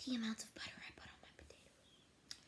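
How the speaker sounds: hushed confession aside; whispering. Real, not acted. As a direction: whisper it like a guilty secret.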